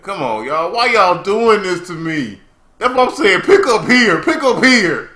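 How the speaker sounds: high, loud pleading protest; exasperated complaint. Real, not acted. Complaining about the recap.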